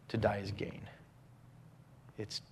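hushed devotional whisper; reverent whisper. Acted. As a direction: breathe the verse like a secret. Whispered refrain.

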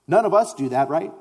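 ordinary talk, nothing marked

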